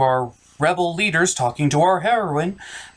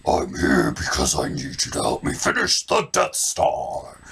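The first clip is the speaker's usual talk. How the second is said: deep villain voice. Acted.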